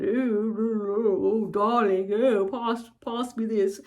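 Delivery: overdone plummy British accent, mocking; David Case. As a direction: badly imitate a posh British "darling" type. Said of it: "if it's too plummy".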